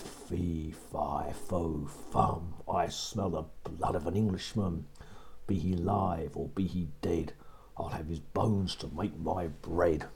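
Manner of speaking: menacing giant. Acted. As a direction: chant it like a hungry giant. Giant's famous chant.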